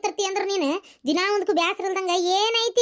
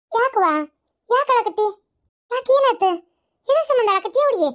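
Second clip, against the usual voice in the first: put-on higher, urgent concern for a crying girl; concerned questioning; 3 semitones higher than usual. Elder finding a girl in tears, concerned questioning. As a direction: ask anxiously, pitched up.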